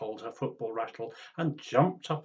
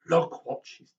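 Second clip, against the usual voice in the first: louder, slow, scandalised; tutting; 4 semitones higher, 11 dB louder, slower than usual. Acted. Other grannies disapproving at the playground, loud slow tutting. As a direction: tut it with disapproval. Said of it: "tutted the other grannies".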